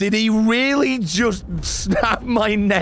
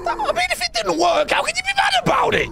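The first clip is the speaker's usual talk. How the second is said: indignant high yell. Real, not acted. Indignant at enemy.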